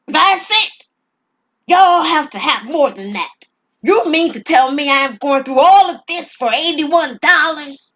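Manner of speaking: high, loud furious disbelief; outraged robber. Acted. Robber raging at the clerk over eighty-one dollars, high and loud. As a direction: explode at the tiny sum.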